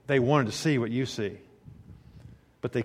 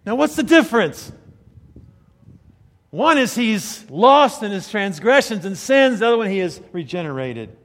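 raised, high-pitched preaching voice; emphatic, louder preacher delivery; forceful preaching; 8 semitones higher, 8 dB louder than usual. Real, not acted. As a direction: drive the point home from the pulpit.

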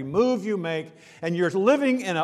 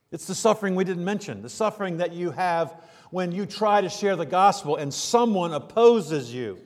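usual speaking voice

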